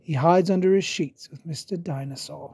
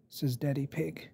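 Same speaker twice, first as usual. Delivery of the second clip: ordinary delivery, nothing marked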